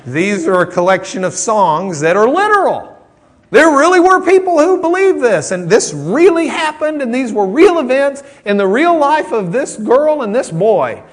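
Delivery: high, loud, emphatic preaching; emphatic insistence. Real, not acted. Preacher pressing the literal view on listeners, high emphatic insistence. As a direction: raise the voice high and insist every word is true.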